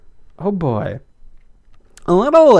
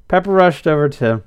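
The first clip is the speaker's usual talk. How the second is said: plain reading voice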